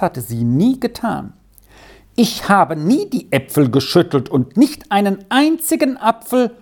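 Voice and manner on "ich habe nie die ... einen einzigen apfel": high, hurt protest; a child insisting she is innocent; indignant girl. A narrator's reading. Girl denying the theft to the farmwife, indignant and high.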